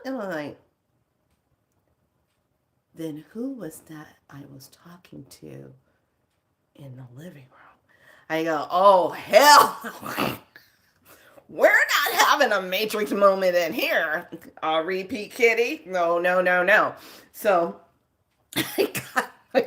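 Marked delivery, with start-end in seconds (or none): 3.0-7.8 unvoiced spooked whisper. Asking the cat in a spooked whisper. 8.7-17.0 loud, firm scolding; loud protest. Retold or imitated. Ordering the strange kitty away, loud and firm. Shooing spirit loudly.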